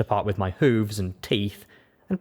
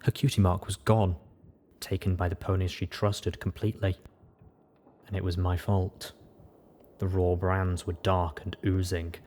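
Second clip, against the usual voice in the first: plain reading voice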